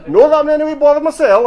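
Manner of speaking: very high, loud disclaimer; loud protest. Acted. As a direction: protest far too much, pitch shooting up. Harry hotly disclaims any football bias.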